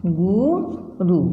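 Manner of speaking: गुरु said slowly with short u; careful demo. Shown as a demonstration. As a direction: draw गुरु out, short उ sounds. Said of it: "गुरु जो शब्द है कैसे आएगा?". (गुरु को धीरे, छोटे उ से बोलना)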